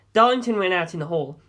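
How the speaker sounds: usual speaking voice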